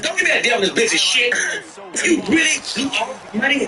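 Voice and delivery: high, fast mock yell; imitating angry wife. Wife yelling at husband about lost money. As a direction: snap at him like a furious wife. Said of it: "wife scolding husband".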